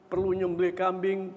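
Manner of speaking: normal talking voice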